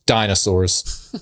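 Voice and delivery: ordinary talk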